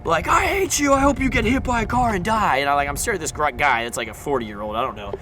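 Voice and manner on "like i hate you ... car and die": high-pitched mock scream at no extra volume; strained, high, ranting impression of a furious driver; imitated screaming. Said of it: "he just started screaming like".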